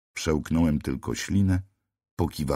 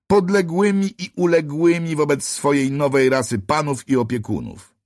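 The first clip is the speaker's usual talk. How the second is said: high, loud proclamation of a master race; lordly pronouncement; 11 semitones higher, 6 dB louder than usual. A narrator's reading. Angel staring down the narrator, high loud proclamation. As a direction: declare it like a triumphant overlord. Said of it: "zawiesił głos".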